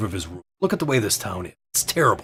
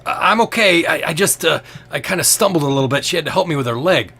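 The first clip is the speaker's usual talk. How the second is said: stammering, raised-pitch cover story; flustered excuse; 7 semitones higher, 5 dB louder than usual. Acted. Hurt man explaining his fall to Killraven, flustered and stammering. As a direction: stumble through an excuse, louder and higher.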